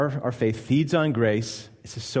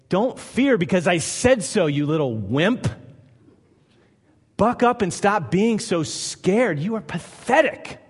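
harsh scolding God; 5 semitones higher than usual. Retold or imitated. Preacher mocking a harsh God who scorns fear. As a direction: sneer at them like a contemptuous tyrant. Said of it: "So God doesn't say".

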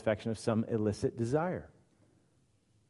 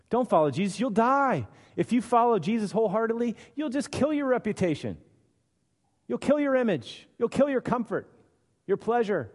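pitched-up, fast taunting warning; mock flesh voice; 8 semitones higher than usual. Preacher voicing the flesh, high fast taunt. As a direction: needle them, higher than the plain talk.

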